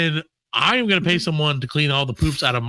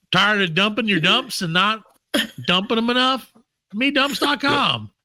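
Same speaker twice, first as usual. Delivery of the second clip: ad read; 3 semitones higher than usual. Acted. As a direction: sell it like a cheesy infomercial. Mock ad pitch.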